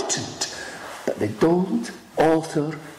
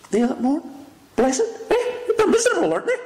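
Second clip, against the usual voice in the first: incredulous; 12 semitones higher, faster than usual. Acted. Preacher mocks the idea, incredulous.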